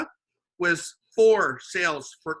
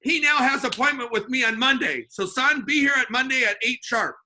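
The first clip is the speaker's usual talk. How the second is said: loud, higher boss voice, laughing at first; 5 semitones higher, 5 dB louder than usual. Retold or imitated. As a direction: boom it out like an impressed boss to the staff. Boss addresses his staff.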